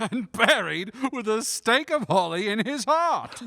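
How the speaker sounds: high, loud laughter through the words; laughing. Acted. Guests at Fred's party laugh at Scrooge, loud and high. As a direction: crack up while telling it.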